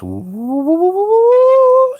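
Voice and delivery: pulsing woo climbing very high; rising woo. Retold or imitated. Man imitates the saucer zinging away, rising woo. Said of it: "keeps going up higher".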